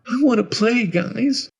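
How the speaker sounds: put-on high, eager little-boy voice; very high childlike voice; Tommy. A narrator's reading. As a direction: call to friends like a small child. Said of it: "I heard Tommy's voice say".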